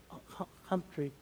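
very soft, slow stammered answer; feeble old man. Retold or imitated. Old veteran giving his name to the girl, feebly. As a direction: imitate a faint, halting old man.

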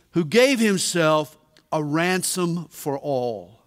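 scripture reading. Acted. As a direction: read the verse with reverence. Reads scripture aloud.

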